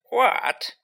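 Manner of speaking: high, sulky one-word retort. A narrator's reading. Ghost girl answers an unwanted greeting, sulky. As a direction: snap back in a high sulk. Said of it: "she said sulkily".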